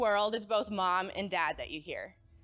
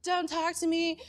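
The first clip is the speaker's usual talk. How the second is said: high, upset mock-teen yell; acted high-pitched wail of protest; tearful teenage outburst; 10 semitones higher, 4 dB louder than usual. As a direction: re-enact a crying teen pushing mom away. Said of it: "I started crying".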